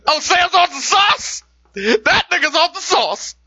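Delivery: high, slow, delighted imitation of the doctor; mock doctor savoring the good news, high and slow; gleeful mock doctor. Imagined doctor gloating.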